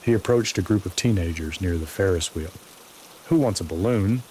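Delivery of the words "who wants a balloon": higher, louder sing-song clown call; showy clown patter with a lilting tune; exaggerated sing-song. A narrator's reading. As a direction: sing it out like a clown luring a crowd. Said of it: "exaggerated sing-song voice".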